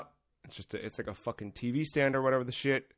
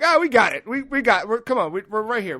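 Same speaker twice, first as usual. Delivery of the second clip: higher, louder strained cheering on; frantic encouragement; 7 semitones higher, 6 dB louder than usual. Retold or imitated. Comic urging the old lady up the stairs, strained cheer. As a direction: hype her up while straining under the weight. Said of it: "And I'm like".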